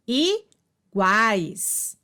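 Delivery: "iguais" drawn out as i-guais; divisão silábica. Shown as a demonstration. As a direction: pause after the i of "iguais", keep guais whole. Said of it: "na mesma sílaba". ("iguais" pausado, em i-guais)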